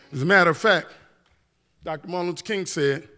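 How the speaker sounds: ordinary talk, nothing marked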